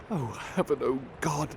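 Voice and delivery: ordinary delivery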